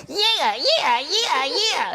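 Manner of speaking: high, repeated mimicked yeahs; high-pitched teasing impression; mocking imitation. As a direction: make fun of a friend working out. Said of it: "making fun of him".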